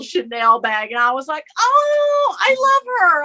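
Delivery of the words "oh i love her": high, gushing retold reaction; acted-out adoring squeal; gushing delight. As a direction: swoon over her, pitched way up. Acting out her delighted reaction.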